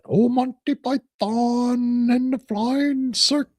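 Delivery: high, slow mock Monty Python British voice; Monty Python accent. Host mocks her British accent, high Monty Python voice. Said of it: "why does she have the Monty Python accident".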